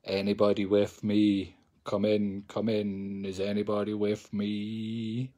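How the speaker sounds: hammed-up séance call; mock spooky medium. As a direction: summon the spirits in a hammy low voice.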